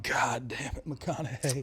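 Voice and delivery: soft, breathy swooning sigh; breathless swoon. Retold or imitated. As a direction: melt and sigh it out. Swooning reaction, much softer.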